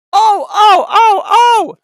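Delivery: high shrill loud truck scream. A narrator's reading. Trucks jolted as couplings tighten, screaming. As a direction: yelp as the couplings jerk. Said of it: "they screamed".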